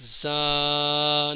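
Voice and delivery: زا with a buzzing z, long level aa; whistled z. Shown as a demonstration. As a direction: buzz the start of زا and hold the vowel. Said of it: "اوپری سی ٹی آتی ہے".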